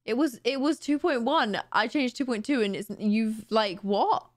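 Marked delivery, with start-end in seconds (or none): none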